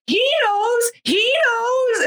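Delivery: high, pleading "he knows, he knows"; desperate old woman. Retold or imitated. Old woman pointing at Eddie, high and pleading. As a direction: cry it out like the frantic old lady.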